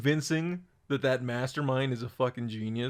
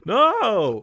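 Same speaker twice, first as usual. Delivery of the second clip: loud denial; 8 semitones higher, 6 dB louder than usual. Real, not acted. Denying tasting friend's dick.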